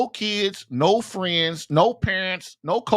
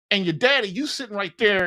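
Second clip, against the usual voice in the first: ordinary talk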